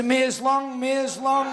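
high, loud put-on woman's voice; mock wife pleading.

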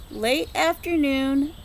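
ordinary delivery

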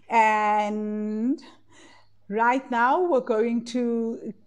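slow, drawn-out calm guiding voice; guided meditation. Acted. Coach guiding the group into breath work, slow meditation voice. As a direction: ease the group into stillness, slowly.

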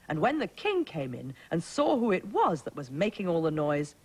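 ordinary delivery, nothing marked

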